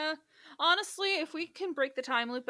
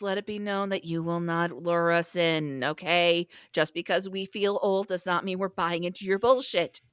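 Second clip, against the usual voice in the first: mock proclamation; 6 semitones lower, 7 dB louder than usual. Addressing Dracula across the multiverse.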